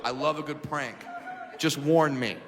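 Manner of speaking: normal talking voice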